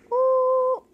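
loud, high siren wail; screaming siren. A narrator's reading. Child reading the fire truck, siren wail. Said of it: "screams the siren of the fire truck".

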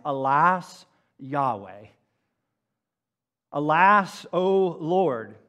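a restrained cry to God, half-performed. Retold or imitated. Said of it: "he literally cries".

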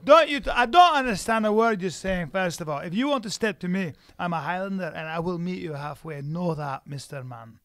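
proud Scot scolding someone; Scottish accent. Acted. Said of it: "Your Scottish voice".

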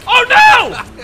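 high alarmed cry; dismayed cry. Real, not acted. Dismay in game.

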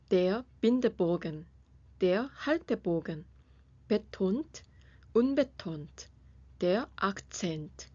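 slow, careful teacher's pronunciation of German terms; slow clear term reading. Shown as a demonstration. Teacher slowly presenting German music terms. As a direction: spell each term out slowly for the class. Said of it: "오늘의 독일 음악 용어를 알아보겠습니다".

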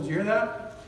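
ordinary talk, nothing marked

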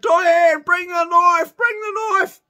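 high, loud shout for help; shouting for a knife while wrestling a turkey, loud and high; calling out. Retold or imitated. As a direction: yell across to the girlfriend to bring the knife. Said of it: "I said to my girlfriend".

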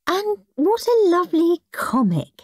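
high-pitched polite praise; gushing granny. Acted. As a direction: praise the gift like a doting granny. Granny politely praises Henry's gift.

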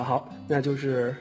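normal talking voice

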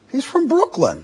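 high-pitched imitation of casting people; mock a casting agent brushing someone off; hammed-up dismissal in a high voice; mock dismissive. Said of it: "those people would say".

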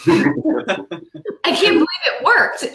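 high, slow, laughing disbelief; amazed delight. Retold or imitated. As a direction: relive the giddy triumph through laughter. Mother retells her delighted disbelief.

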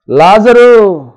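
loud, high, drawn-out call. Acted. Jesus calling the dead Lazarus out, loud summoning call. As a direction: call the name slowly to someone far off. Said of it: "వెళ్ళి పిలుస్తా ఉన్నాడు".

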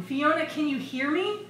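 high searching call, rising at the end; shouting. A narrator's reading. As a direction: call out for an answer. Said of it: "he shouted, coughing".

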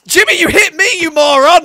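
high, loud angry outburst; angry yell. Real, not acted. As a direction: snap at a clumsy teammate. Teammate hit him in fight.

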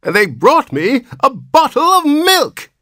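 put-on very high old man's outraged whine; shrill protest. A narrator's reading. Old man indignant at a bottle of milk, shrill. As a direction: push the aged voice right up in shrill protest. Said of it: "his voice rose to a shrill note of protest".

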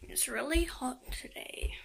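soft unvoiced whisper. Real, not acted. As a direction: hush it, keep it secret. Whispering quietly near others.

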